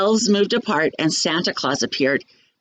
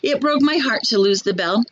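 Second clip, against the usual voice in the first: usual speaking voice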